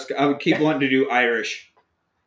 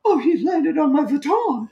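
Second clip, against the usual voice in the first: rich lady; 12 semitones higher than usual. Retold or imitated. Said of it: "some rich lady like".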